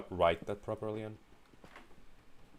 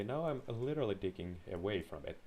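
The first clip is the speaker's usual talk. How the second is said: ordinary talk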